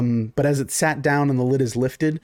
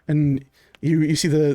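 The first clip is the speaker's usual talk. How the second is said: usual speaking voice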